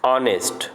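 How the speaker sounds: ऑनेस्ट with a silent h, starting on the vowel. Shown as a demonstration. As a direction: say ऑनेस्ट without any h sound. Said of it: "इसको हॉनेस्ट नहीं पढ़ेंगे". (ऑनेस्ट को बिना ह के बोलें)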